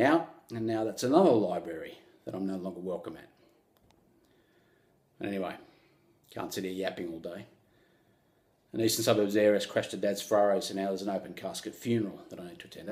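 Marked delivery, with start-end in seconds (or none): none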